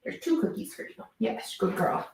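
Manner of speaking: soft high praise for a pet; praising a dog. Real, not acted.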